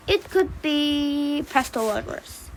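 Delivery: slow, drawn-out dread; ominous. Acted. Papa Pine names the worst kind of lodger, ominously. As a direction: draw it out like the worst possible threat.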